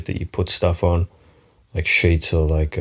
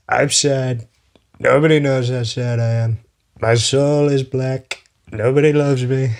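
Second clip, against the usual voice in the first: sad Trump impression; 7 semitones higher than usual. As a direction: play a whiny, self-pitying Trump, much higher than your own. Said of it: "I can't fucking do the impression".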